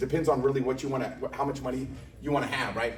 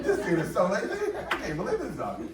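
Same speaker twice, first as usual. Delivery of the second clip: put-on kid laughing; 6 semitones higher than usual.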